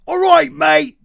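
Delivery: loud, high Australian accent; big, hammed-up Australian greeting. Shown as a demonstration. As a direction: belt it out in a showy Australian accent. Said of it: "You wanna hear my Australian one?".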